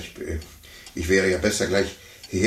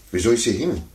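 ordinary talk

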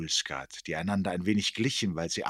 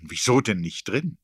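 louder, higher amused question; amused disbelief; 4 semitones higher, 8 dB louder than usual. A narrator's reading. Narrator has to laugh at Erik, amused and incredulous. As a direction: challenge it loudly, tickled. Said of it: "jetzt musste ich selber lachen".

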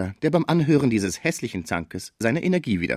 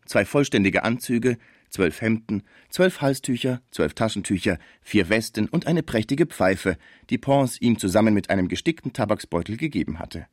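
ordinary delivery, nothing marked

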